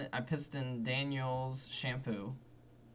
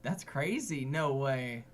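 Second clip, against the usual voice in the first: mock fake interest; 8 dB louder than usual. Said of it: "I can't even pretend to be that interested".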